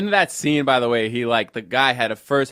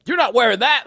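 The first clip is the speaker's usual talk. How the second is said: mock dad yell; 9 semitones higher than usual. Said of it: "and, like, yelling".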